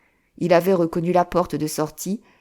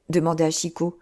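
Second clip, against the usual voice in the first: ordinary delivery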